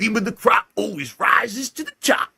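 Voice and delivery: slow, high, hammed-up wrestler voice; Macho Man impression. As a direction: growl it like a bad wrestler. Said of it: "That's not how he talks".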